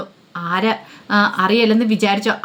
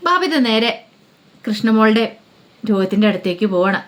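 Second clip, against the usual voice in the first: plain reading voice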